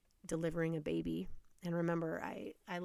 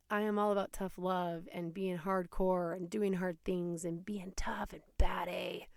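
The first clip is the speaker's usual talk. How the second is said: ordinary talk, nothing marked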